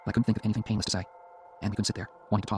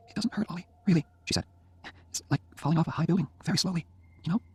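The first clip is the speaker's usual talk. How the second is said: put-on frail dying girl; 8 semitones higher than usual. A narrator's reading. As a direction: speak gently from a deathbed. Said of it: "dying Jenny speaks".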